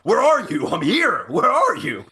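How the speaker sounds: high, loud, pushy demanding voice; same abrasive caller. Retold or imitated. Abrasive caller phoning again, loud and demanding. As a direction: demand it impatiently like the rude caller. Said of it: "the voice is the same voice".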